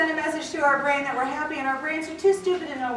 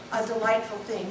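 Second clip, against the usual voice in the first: ordinary delivery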